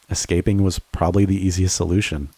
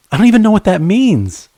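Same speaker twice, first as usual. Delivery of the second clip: much higher and louder, frustrated; exasperated shout; 12 semitones higher, 6 dB louder than usual. Acted. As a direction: yell it after her as she runs. Chasing partner, exasperated shout.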